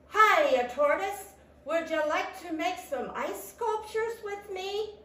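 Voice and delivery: high-pitched, eager animal voice; friendly rabbit. A narrator's reading. Rabbit inviting Tortoise to make ice sculptures, friendly. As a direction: open loud, keep the invitation high.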